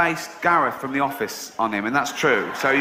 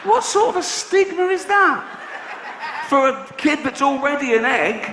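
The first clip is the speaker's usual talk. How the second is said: laughing outrage; 10 semitones higher than usual. Real, not acted. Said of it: "mock outrage, laughing".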